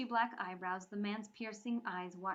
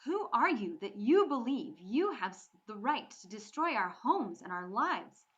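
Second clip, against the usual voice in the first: loud, defiant challenge; defiant anger; 2 semitones higher, 9 dB louder than usual. Acted. Bree defying Mikkel after his outburst, angry. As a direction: throw the question back at him. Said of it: "Bree would not stop speaking".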